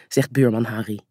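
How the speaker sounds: ordinary delivery, nothing marked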